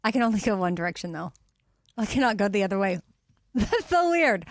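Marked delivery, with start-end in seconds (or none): 3.3-4.5 laughing. Real, not acted. Amazed at own tongue.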